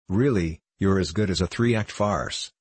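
ordinary delivery, nothing marked

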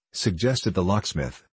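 ordinary delivery

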